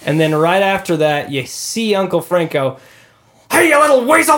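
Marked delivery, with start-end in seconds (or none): 3.5-4.3 high, loud mock scream; imitated scream. Player imitating Uncle Frank caught singing in the shower, screaming. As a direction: yell it like an outraged film uncle. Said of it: "and he screams".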